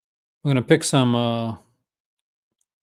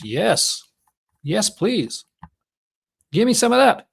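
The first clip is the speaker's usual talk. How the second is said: excited; 8 semitones higher, slower than usual. Real, not acted. Eager reaction to a film pick.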